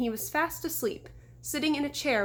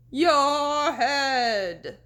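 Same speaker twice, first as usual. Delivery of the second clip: slow, loud ghostly groan; 4 semitones higher, 7 dB louder than usual. A narrator's reading. Fake horseman demanding the deputy's head, groaning. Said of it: "Sabrina groaned".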